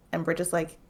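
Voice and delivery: ordinary talk, nothing marked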